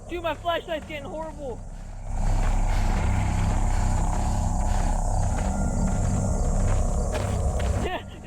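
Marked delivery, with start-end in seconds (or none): 1.6-8.2 long loud breathless laughing fit; hysterical laughter. Real, not acted. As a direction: crack up helplessly. Long laugh after scare.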